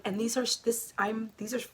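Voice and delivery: tripping over false starts; stuttering. Real, not acted. Knitter showing stripes loses her words, stuttering. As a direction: stumble and restart the phrase, tongue-tied. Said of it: "I'm stuttering".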